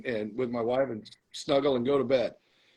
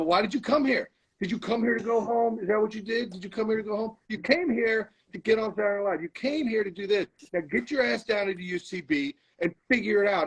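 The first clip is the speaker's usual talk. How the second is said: higher-pitched mock scolding of his lazy self; a half-hearted scolding, like a coach berating a player; mock yell at himself; 7 semitones higher than usual. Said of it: "yelled at myself".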